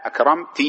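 "أكرمتِ" ending in a clear -ti; demonstrating kasra. Shown as a demonstration. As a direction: separate the final -ti of "أكرمتِ". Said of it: "فتقول مثلًا". ("أكرمتِ" بكسر التاء)

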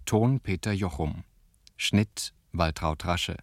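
ordinary talk, nothing marked

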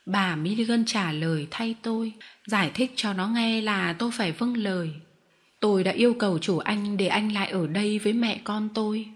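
ordinary delivery

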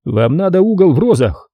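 high-pitched garbled officialese; duty sergeant. A narrator's reading. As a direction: redirect the caller in a high phone-cop voice. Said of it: "сообщил он".

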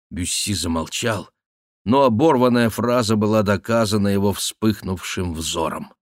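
plain reading voice

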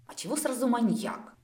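offended. A narrator's reading. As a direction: protest as if unfairly accused. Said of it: "также шёпотом обиделась я".

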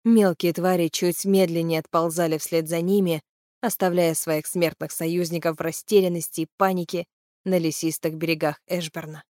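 ordinary delivery, nothing marked

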